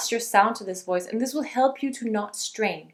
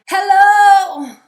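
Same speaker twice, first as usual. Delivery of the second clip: yelled hello, higher and much louder; strained yell; 9 semitones higher, 14 dB louder than usual. Shown as a demonstration. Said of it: "then you're yelling and you're straining".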